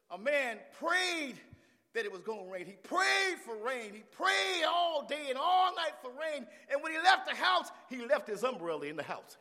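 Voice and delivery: loud, high preacher's storytelling; impassioned preaching. Acted. Preacher telling the church of praying for rain, impassioned.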